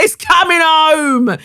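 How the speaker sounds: high, loud, long-drawn football bellow; mock fan shout. Mocking fans with the gall to say it, drawn-out shout. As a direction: holler it slowly like a deluded fan. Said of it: "be like".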